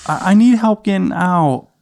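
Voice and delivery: put-on high little-boy voice; Adam. Stuck little boy calling to dad, high child voice. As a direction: imitate a small child calling for help. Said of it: "And he goes".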